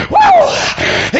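high preacher's "woo"; revival whoop. Real, not acted. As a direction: let out an exultant whoop. Preacher whoops mid-sermon.

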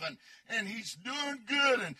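usual speaking voice

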